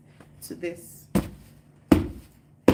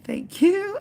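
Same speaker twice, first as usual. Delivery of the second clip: high squealed thank you; squeaky thanks; 14 semitones higher, 6 dB louder than usual. Real, not acted. Thanking a viewer in a sudden high squeal.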